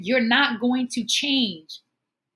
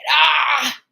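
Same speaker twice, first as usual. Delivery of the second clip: loud, high laugh to hold back tears; a real laugh that is half a sob; laughing not to cry; 5 semitones higher, 7 dB louder than usual. Real, not acted. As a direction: laugh hard so the tears don't come. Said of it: "That's what you do when you don't wanna cry".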